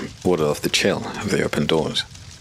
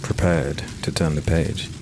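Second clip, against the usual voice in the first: eerie host intro; 4 dB quieter than usual. A narrator's reading. Host greeting the reader, ominous and hushed.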